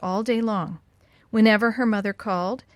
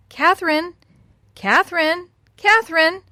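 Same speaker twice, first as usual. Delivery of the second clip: higher, louder calls of a name; calling out; 8 semitones higher, 6 dB louder than usual. A narrator's reading. Old woman tests the parrot, calling Catherine loudly. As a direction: sing out a name to summon someone. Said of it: "when the old woman called".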